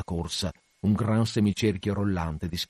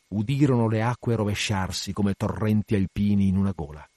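usual speaking voice

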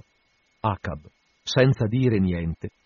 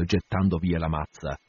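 ordinary delivery

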